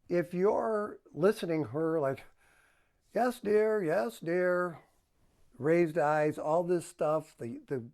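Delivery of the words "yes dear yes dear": insincere. Shown as a demonstration. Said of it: "that's not sincere".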